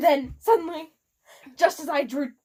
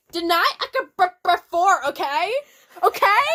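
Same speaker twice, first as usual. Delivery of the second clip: defensive rant; 5 semitones higher, 5 dB louder than usual. Acted. Author's note read as rant.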